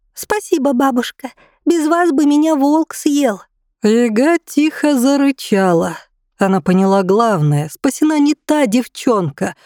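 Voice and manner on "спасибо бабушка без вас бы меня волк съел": put-on small high girl's voice, squeaking; high squeaky girl voice. A narrator's reading. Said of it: "пискнула она".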